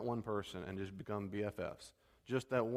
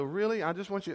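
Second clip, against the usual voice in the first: higher, louder faux-sincere push; mock-innocent pressing; 7 semitones higher, 5 dB louder than usual. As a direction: press him while acting innocent. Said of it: "And I go".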